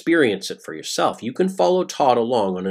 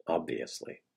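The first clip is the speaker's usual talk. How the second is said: soft, lower, sardonic "Obviously"; dry echo; 7 semitones lower, 14 dB quieter than usual. Real, not acted. Narrator mocks Todd's quote with a softer, dry echo. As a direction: let "Obviously" drop away, softer, with a dry sarcastic edge.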